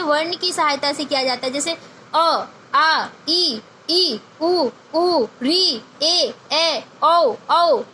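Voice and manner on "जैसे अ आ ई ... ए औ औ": each vowel drawn out clearly; vowel recitation. Shown as a demonstration. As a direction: recite the vowels slowly and distinctly. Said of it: "तो ये जो है बच्चे आपके स्वर हैं". (स्वरों का स्पष्ट उच्चारण)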